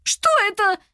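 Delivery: high panicked yell. A narrator's reading. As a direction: yell it out in shock. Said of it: "заорала она". (орёт в панике)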